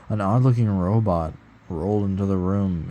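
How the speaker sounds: ordinary delivery, nothing marked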